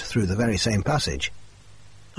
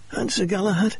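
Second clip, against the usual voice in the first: much higher, strained voice for Murray; injured man's voice; 10 semitones higher than usual. Acted. Murray quizzing Watson on legends, higher pitched voice. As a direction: voice Murray high and tense.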